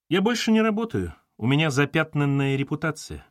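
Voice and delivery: ordinary delivery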